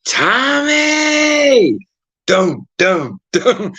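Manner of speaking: high shrill call out; mom yelling. Retold or imitated. Mom on the step yelling for Tommy, a high call. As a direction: call the name up the street at the top of your voice. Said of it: "she's going".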